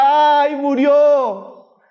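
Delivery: imitated mourners' lament; mock funeral wail. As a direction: cry out like mourners following a coffin. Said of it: "la gente decía".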